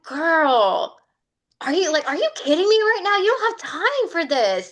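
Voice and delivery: high, scolding self-talk, half-laughing; scolding herself. Retold or imitated. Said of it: "I got real mad at myself".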